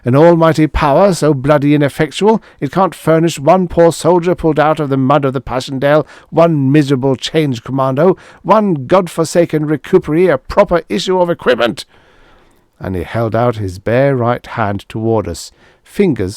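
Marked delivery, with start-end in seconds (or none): 0.0-11.8 high, loud furious climax; climactic outburst. A narrator's reading. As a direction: explode at the peak of the rant. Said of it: "rising to a climax".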